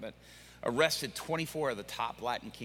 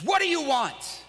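high, loud, hostile snap; shackled gang leader confronting the pastor, furious; angry demand; 12 semitones higher, 6 dB louder than usual. Retold or imitated. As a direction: throw the question at him in rage.

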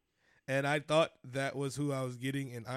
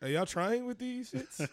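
high, loud, drawn-out question; mock disbelief, pitched way up and slowed down; incredulous challenge; 11 semitones higher, 6 dB louder, slower than usual. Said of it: "I'm like".

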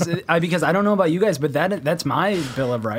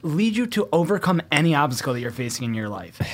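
usual speaking voice

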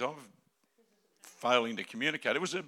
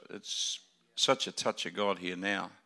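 ordinary talk, nothing marked

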